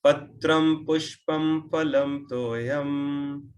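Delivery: very slow chanting, each word apart; drawn-out line for memorizing; slow recitation. Shown as a demonstration. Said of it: "you keep repeating one line".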